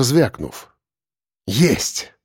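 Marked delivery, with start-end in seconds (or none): 1.5-2.1 exhaled word. A narrator's reading. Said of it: "выдохнул я".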